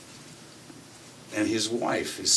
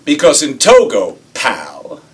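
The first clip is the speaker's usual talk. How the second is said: loud, punched folksy lecture, lightly acted; louder, higher put-down in a folksy boss act; gentleman farmer act; 6 semitones higher, 12 dB louder than usual. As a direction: set the kid straight with a hint of gentleman farmer. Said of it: "I'm doing my gentleman farmer number".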